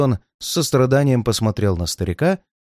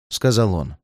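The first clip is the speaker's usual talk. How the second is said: usual speaking voice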